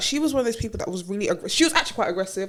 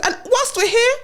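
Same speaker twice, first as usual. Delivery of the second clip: gleeful reveal, laughing; 11 semitones higher, 3 dB louder than usual. Retold or imitated. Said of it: "to make it even sweet".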